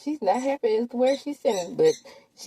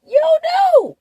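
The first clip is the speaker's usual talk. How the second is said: high mock retort; 7 dB louder, much slower than usual. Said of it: "says Qua".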